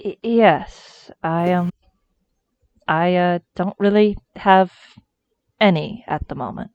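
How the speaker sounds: halting, stammered confession; hesitant admission. Acted. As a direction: stumble awkwardly through admitting it. Character sheepishly admits lacking paperwork.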